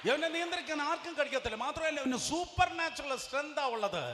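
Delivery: high, loud pulpit proclamation; loud revival preaching about a man no chain can hold; fiery preaching. Acted. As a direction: preach it with full fire. Preaching at full force.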